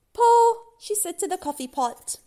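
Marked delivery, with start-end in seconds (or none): 0.1-0.6 very high, loud one-word order; magic command. Acted. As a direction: order the pot sharply. Magic command to coffee pot.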